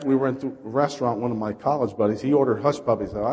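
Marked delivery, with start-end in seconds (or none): none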